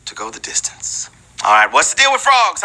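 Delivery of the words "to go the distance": whispered lyric. Acted.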